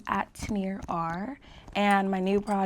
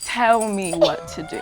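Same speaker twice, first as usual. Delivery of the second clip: slow, even-voiced order; measured, controlled "tell me what to do"; calm command. Shown as a demonstration. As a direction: give the order calmly, without shouting. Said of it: "nice, calm tone".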